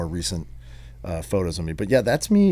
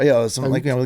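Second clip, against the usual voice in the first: ordinary talk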